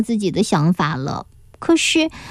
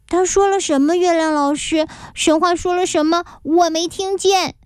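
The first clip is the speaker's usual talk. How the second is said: put-on high child voice calling out; higher childlike complaining voice; 8 semitones higher than usual. A narrator's reading. Back-row classmates calling out.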